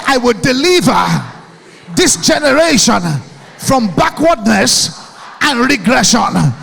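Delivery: loud chant-like vow for the crowd to repeat; shouted affirmation. Shown as a demonstration. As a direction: lead the hall in a rallying vow.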